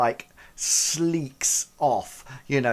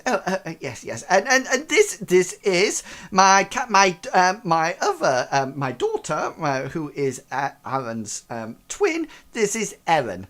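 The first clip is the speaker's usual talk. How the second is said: high, stumbling introduction; flustered father; 5 semitones higher, 4 dB louder than usual. Acted. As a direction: fumble for words like a nervous host. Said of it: "he goes".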